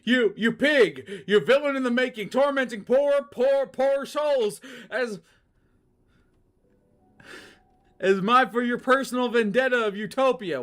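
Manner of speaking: put-on high, loud outraged old man; high, loud indignant cry; homeless man's voice. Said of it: "this is the homeless guy".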